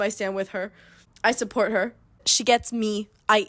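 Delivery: ordinary delivery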